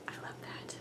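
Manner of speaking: breathy delighted whisper. Real, not acted. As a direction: whisper it to yourself in awe. Delighted aside after reading label.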